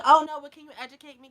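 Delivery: loud mock plea from the guy; loud mocking plea, imitating him; mock pleading guy. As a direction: ham up his loud begging. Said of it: "he was like".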